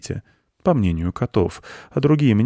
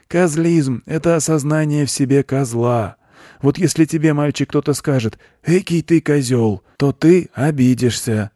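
raised, earnest lecturer's voice; preaching goat; 8 semitones higher, 4 dB louder than usual. A narrator's reading. Goat explains his doctrine to a boy, preaching. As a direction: expound it like a guru, pitched up.

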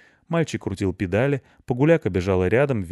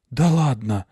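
frightened; 4 semitones higher, 5 dB louder, slower than usual. A narrator's reading. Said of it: "испуганно огляделся Рыжопиус".

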